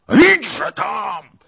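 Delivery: very loud, high-pitched bellow; furious roar. A narrator's reading. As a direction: roar the order at him. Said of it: "ryknął Tuchaj Bey".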